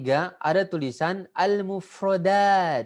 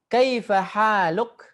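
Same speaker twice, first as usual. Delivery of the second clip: higher, slow and louder question; model reading; 4 semitones higher, 5 dB louder, slower than usual. Shown as a demonstration. Teacher reading the Arabic question for the class to copy, slow and loud. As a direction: sing it out slowly for repeating. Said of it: "Ikuti seperti saya baca".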